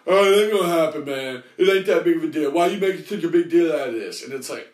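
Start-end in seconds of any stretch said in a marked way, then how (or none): 0.1-1.4 louder hammed-up bro brush-off; mock slacker voice dismissing the debt; mocking the guy. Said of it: "he's just gonna". 1.6-2.5 louder hammed-up bro voice; mock slacker brushing off the debt; mocking imitation. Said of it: "he's just gonna". 2.5-4.2 louder hammed-up bro voice; mock slacker brushing off the debt; mocking imitation. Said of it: "he's just gonna".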